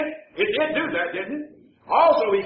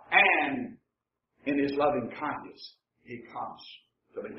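hushed reassurance; 7 semitones lower, 7 dB quieter than usual. Acted. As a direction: drop low and very soft, almost reverent. Litany of distress resolves into comfort.

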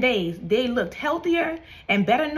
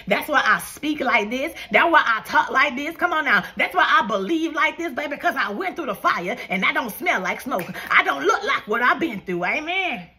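high, punchy repeated declarations; driving sermon rhythm, hitting each phrase; testifying preacher; 4 semitones higher than usual. Real, not acted.

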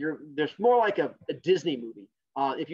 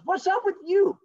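high squeaky retort; 5 dB louder than usual. Acted. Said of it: "And he goes".

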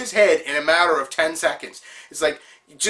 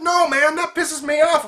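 hammed-up fury at the French players; mock angry rant; 9 semitones higher than usual. Teammate Zeramis fuming over S moves, outraged shout. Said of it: "he goes on this huge rant".

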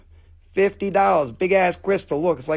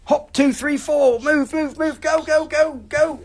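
urging hurry; 8 semitones higher than usual. Real, not acted. Urging someone to move fast.

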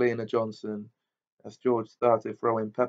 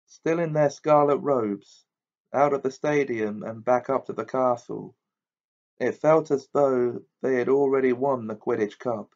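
usual speaking voice

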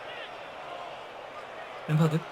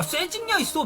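usual speaking voice

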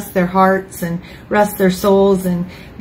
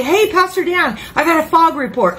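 higher, excited churchgoer calling out; eager congregant; 7 semitones higher than usual. Retold or imitated. Congregant greeting the pastor with news, eager. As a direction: mimic a keen member bursting to share. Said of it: "people would come in and say".

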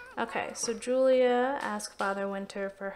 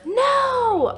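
much higher, louder cry of "No!"; real dismayed shout; 14 semitones higher, 11 dB louder than usual. Real, not acted. The sim misses his present.

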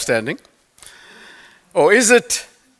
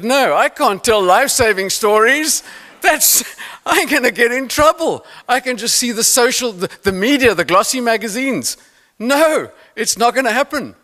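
mock alarmed refusal; 7 semitones higher than usual. Panicked inner refusal.

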